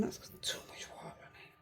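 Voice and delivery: whispered aside to herself; whispering. Real, not acted. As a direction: breathe it out under her breath. Painter's aside while working.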